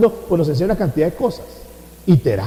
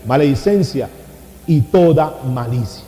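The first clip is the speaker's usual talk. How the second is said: slow, low scripture reading; solemn scripture reading; 5 semitones lower than usual. Acted. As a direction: read the verse slowly and gravely. Quoting scripture slowly. (lectura bíblica lenta y solemne)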